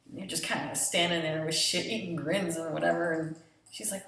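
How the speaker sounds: ordinary talk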